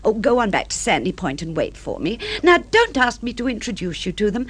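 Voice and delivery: ordinary delivery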